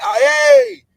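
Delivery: high, loud burst of excitement; blurting it out, worked up; excited outburst. Real, not acted. Excited retelling.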